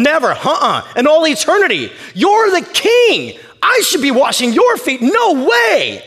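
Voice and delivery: high, loud, vehement refusal; outraged, stretched-out exclamations; Peter protesting. Acted. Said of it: "This is Peter saying".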